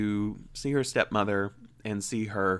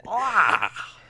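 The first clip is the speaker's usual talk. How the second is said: startled cry; 9 semitones higher, 6 dB louder than usual. Real, not acted. Reacting to own voice.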